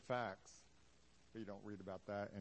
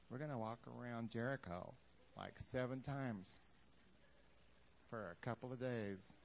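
sheepish plan; 7 dB quieter than usual. Acted. Leader sheepishly explaining the plan to the soldiers. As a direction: admit the silly plan quietly. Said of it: "What's the plan?".

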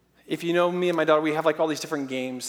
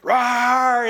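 loud high playful rawr; loud playful child lion roar; 6 semitones higher, 8 dB louder than usual. Retold or imitated. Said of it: "she's like".

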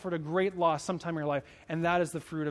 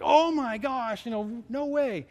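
slow, higher and louder gasp of wonder; amazed disbelief; 4 semitones higher, 3 dB louder, slower than usual. Retold or imitated. Pastor retelling his own amazed reaction to a text, slow and marvelling. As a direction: draw it out like you can't believe it. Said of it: "And I'm like".

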